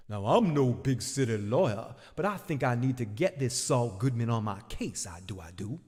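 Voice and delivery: lightly voiced folksy lawyer; a hint of a folksy lawyer. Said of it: "Better call Davos".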